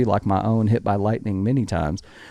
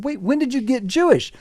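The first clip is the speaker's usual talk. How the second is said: high-pitched incredulous question; baffled disbelief; 12 semitones higher than usual. Retold or imitated. Husband questioning his wife's news, high-pitched baffled disbelief. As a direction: retell it as a thrown, puzzled husband.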